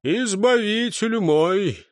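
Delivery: high, slow exclamation; effusive gratitude. A narrator's reading. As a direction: draw out the thanks in reverent praise. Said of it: "согнулся в поясном поклоне маяк".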